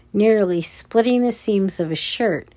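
ordinary delivery, nothing marked

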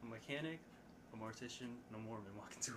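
very faint, far from the mic; too far from the mic. Real, not acted. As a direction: say it away from the mic. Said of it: "lean into the mic and give us that one again".

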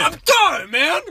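high, screamy comic yell; Tony Brothers voice. Acted. Answering the other Tony brother, screamy yell. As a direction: holler back at full volume. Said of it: "That's our Tony Brothers voice".

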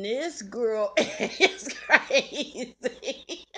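slow, laughing through the words. Real, not acted. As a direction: crack up about your sister. Laughing at sister.

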